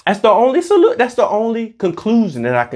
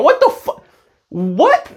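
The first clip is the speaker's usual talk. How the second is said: squeaky disbelief; 12 semitones higher, slower than usual. Real, not acted. Reacting to manifesting posts.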